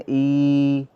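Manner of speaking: ई held long and slow; drawn-out vowel. Shown as a demonstration. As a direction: stretch ई out, three times longer. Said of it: "इसको हम बोल सकते हैं". (ई को देर तक खींचें)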